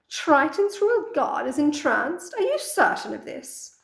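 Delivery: eager and delighted, rising high; excited sea witch. Acted. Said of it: "face dances with excitement as she utters".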